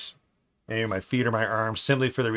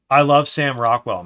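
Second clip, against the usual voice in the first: usual speaking voice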